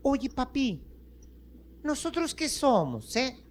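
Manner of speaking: put-on high-pitched baby voice; baby bean. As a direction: ask Daddy in a tiny child's voice. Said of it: "le dice el bebé".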